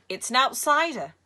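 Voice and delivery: put-on a high, loud Egyptian-accented Sale; lightly done Cairo marketplace accent, proud; heavy Cairo accent. A narrator's reading. Villain Sale shows the boy his jellyfish, Cairo accent. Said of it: "He had a heavy accent brought with him from the Cairo marketplace".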